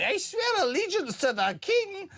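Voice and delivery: put-on high, prissy posh English accent; mock posh English accent. Host mocking an MP's sworn oath, high posh English accent. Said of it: "middle-class English accent".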